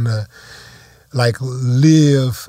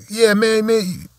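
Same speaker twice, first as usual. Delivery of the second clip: retold mad dude; 8 semitones higher, much slower than usual.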